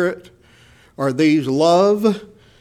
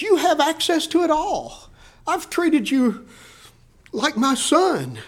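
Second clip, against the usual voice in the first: much higher, earnest father's voice; pleading father; 8 semitones higher than usual. Retold or imitated. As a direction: coax the sulking son to be glad. Father answers the older son.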